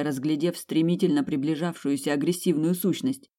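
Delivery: plain reading voice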